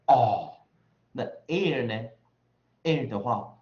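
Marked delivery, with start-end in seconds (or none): 0.1-0.5 "哦" loud and long with no R trill at the end; vowel without R. Shown as a demonstration. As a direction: stop "哦" before any R colour creeps in. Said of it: "不要把儿音抖出来". (英式 "哦"，不抖出儿音)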